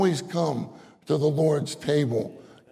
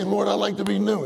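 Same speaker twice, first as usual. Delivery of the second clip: higher, fast eager plea; eager prayer; 6 semitones higher, faster than usual. Pretend. Believer praying quickly and eagerly to the Lord. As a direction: blurt the prayer out happily. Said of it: "You say".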